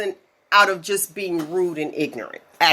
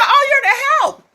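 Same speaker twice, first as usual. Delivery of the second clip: put-on old lady's voice; 5 dB louder than usual. Retelling the patient's line, in a high old-lady voice. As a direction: mimic a ninety-year-old patient sweetly. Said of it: "She said".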